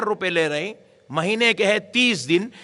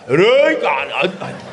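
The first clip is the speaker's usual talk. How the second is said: mock rough shout; 2 semitones lower, 5 dB louder, much slower than usual. Mocking a rude crowd shout.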